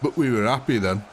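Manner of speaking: level, broad Yorkshire reminiscing; put-on nostalgic Yorkshire voice; Yorkshire accent. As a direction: round off the tale in broad Yorkshire. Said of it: "you could say that in a Birmingham accent".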